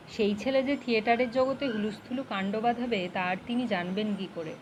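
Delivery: usual speaking voice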